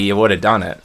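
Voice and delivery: usual speaking voice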